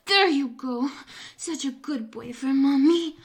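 heated climax urging; breathless urging. Acted. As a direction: push him over the edge, voice climbing. Acted climax, urging, higher louder.

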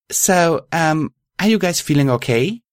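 lifted, uncertain character voice; tentative check-in. Acted. Hesitant check on friends.

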